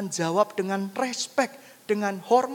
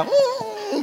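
high sustained motor hum; mock racing-bike whine; imitated engine revving; 11 semitones higher than usual. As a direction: hum like a racer speeding off. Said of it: "menjadi pembalap terkenal".

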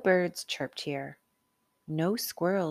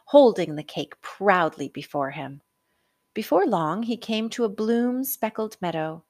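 plain reading voice

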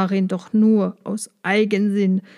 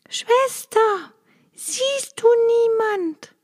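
much higher, panicked wife; trembling cry; 12 semitones higher, slower than usual. A narrator's reading. Terrified wife crying to her sister, very high. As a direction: cry up the tower in trembling fear. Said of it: "schrie die Zitternde".

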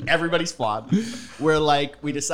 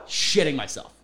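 usual speaking voice

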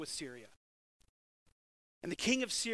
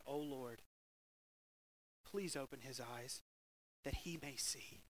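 lower, much softer prayer; hushed prayer; 6 semitones lower, 10 dB quieter than usual. Acted. Elisha to the Lord, a hushed prayer. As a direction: pray it softly, almost under breath.

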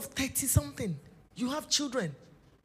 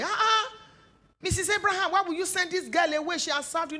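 pleading bystander; 7 semitones higher, 5 dB louder than usual. Retold or imitated.

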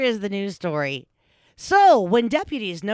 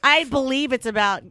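ordinary talk

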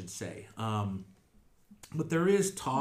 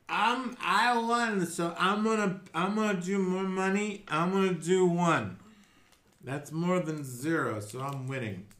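put-on boastful drunk; 6 semitones higher, 5 dB louder than usual. Gloating drunk Skruldar at dice.

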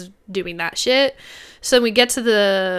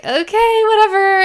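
high, louder sarcastic okay whatever; mock-bright brush-off; exasperated; 9 semitones higher, 5 dB louder than usual. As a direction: brush him off, clearly annoyed. Fed-up retort to drunk boyfriend.

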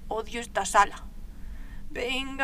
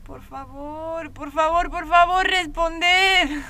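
put-on girl calling to unseen wardens, growing into a shout; pleading, building to a shout; slower than usual. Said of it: "Entonces gritaré más alto".

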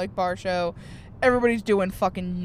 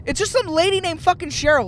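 much higher, louder exasperated rant; exasperated retort; 10 semitones higher, 3 dB louder than usual. Retold or imitated. Comedian ranting back at the tour guide, high and loud. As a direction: blurt it out in disbelief. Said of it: "I'm like".

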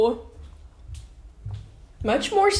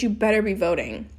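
ordinary talk, nothing marked

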